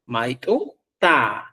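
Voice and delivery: "Maitu ta" drawn out, the ta set apart; slow "Maitu ta" to point out the ta; slow stressed suffix. Shown as a demonstration. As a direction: stretch "Maitu ta" to show the suffix. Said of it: "aquí está el ta".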